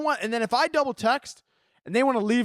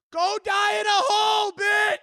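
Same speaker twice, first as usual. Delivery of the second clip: high furious yell; ranting shout; 11 semitones higher than usual. Real, not acted. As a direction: yell it at the critic. Ranting at judgy partner.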